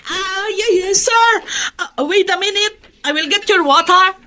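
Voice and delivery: hurried, anxious to please; flustered maid. Acted.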